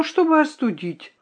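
ordinary delivery, nothing marked

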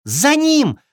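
high-pitched rallying cry; fawn's cry. A narrator's reading. Fawn rallies friends after the thief, crying out. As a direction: cry out high to start the chase. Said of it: "закричал оленёнок".

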